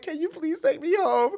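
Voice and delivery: high tearful laugh-cry; half laughing, half sobbing; crying plea. Retold or imitated. Asking his friend to take him home, laugh-crying high. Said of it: "and I'm like".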